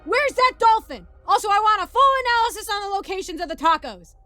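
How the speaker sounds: loud, high drill-sergeant yelling; military shouting. Acted. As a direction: bellow it like a sergeant giving orders. Sergeant and private bark in story.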